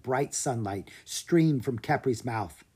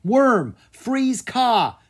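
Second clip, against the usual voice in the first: high, loud command; boy commanding his dragon to freeze; calling out; 10 semitones higher, 7 dB louder, much slower than usual. Acted. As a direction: shout the order to his dragon. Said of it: "Drake called out".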